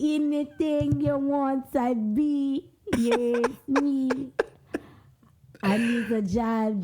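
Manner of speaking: put-on slow, playful Jamaican accent. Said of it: "how you doing Jamaican accent?".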